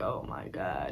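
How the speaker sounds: low, muted groan of disbelief; weary exasperation dropped under the voice; exasperated. Retold or imitated. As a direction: mutter it low, fed up. Exasperated reaction to cousin's complaint.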